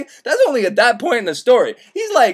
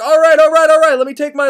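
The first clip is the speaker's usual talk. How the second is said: higher, louder hurried all rights; flustered agreement; 7 semitones higher, 5 dB louder than usual. Retold or imitated. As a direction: blurt it out in a mock panic.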